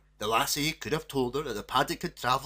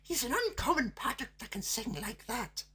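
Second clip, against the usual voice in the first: put-on cross girl; 12 semitones higher, 4 dB quieter than usual. A narrator's reading. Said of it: "replied her daughter crossly".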